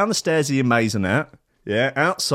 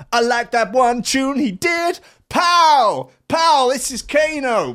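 mock fanboy; 11 semitones higher than usual. Mocking [name] as [name] fan.

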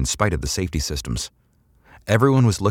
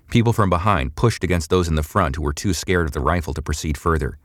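ordinary delivery, nothing marked

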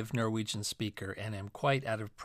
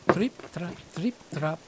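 little goat's hooves; 2 semitones higher than usual. A narrator's reading. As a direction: tap out small hoofsteps in words. Youngest goat's hooves on bridge.

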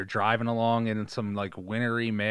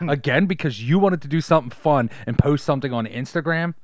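high, loud whiny complaints; whiny complaining wife; 5 semitones higher, 6 dB louder than usual. Pretend. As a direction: nag like a fed-up wife. Wife complaining in car scene.

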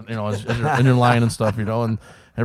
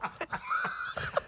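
breathy laughing burst after the yell; burst of laughter; 12 dB quieter than usual. Real, not acted. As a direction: laugh hard at yourself. Laughs at own story.

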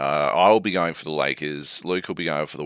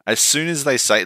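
ordinary talk, nothing marked

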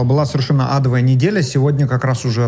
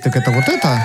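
shouted sound effect. Shown as a demonstration. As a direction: shout out a comedy sting. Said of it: "что у меня есть".

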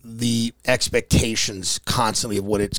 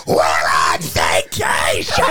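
lower, slow, laughing mock yell; mock screaming mom; 8 semitones lower, much slower than usual. Teller retelling mom at the pool, mock yell with laughter. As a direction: bellow it like a frazzled mother. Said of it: "she just screamed".

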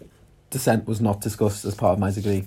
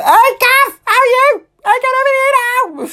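much higher and louder, squealing greeting; loud high-pitched impression; 9 dB louder than usual. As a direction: shriek it like an excited woman across the room. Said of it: "She's like".

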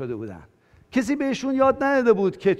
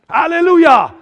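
high, loud hallelujah shout; triumphant hallelujah; 9 semitones higher, 11 dB louder, slower than usual. Real, not acted. Preacher celebrating before the congregation, jubilant shout. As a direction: shout it out in victory.

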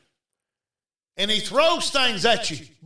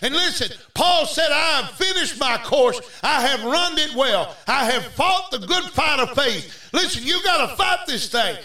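rousing, driving sermon delivery; full-force preaching; 3 semitones higher than usual. Real, not acted. Preacher urging the congregation to fight, full-force preaching. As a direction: preach it hard, phrase after phrase.

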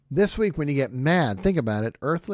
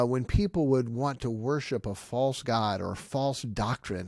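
usual speaking voice

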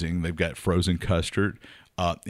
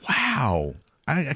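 whispered mock disbelief; 8 semitones higher, slower than usual. Real, not acted. Mock shock at co-host.

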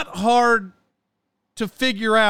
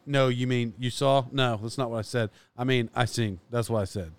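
much lower, softer retold exchange; quiet retold exchange; 8 semitones lower, 6 dB quieter than usual. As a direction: replay the old argument under his breath. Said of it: "She would".

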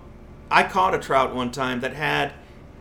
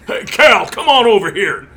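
loud, higher growly biker call; 6 semitones higher, 11 dB louder than usual. Retold or imitated. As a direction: bellow across the yard like a big biker. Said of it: "in that big growly biker voice".

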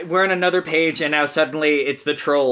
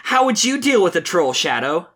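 Sonic, earnest; 2 semitones higher than usual. Acted. Reads Sonic's comic lines.

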